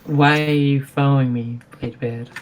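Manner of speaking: usual speaking voice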